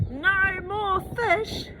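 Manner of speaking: slow, high, loud joyful truck voice; old truck shouting with joy. A narrator's reading. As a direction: cheer it out slowly, high and loud. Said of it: "old Truck shouts with joy".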